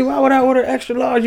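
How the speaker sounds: hammed-up falsetto outrage; shrieking customer. Complaining about a wrong-size baby.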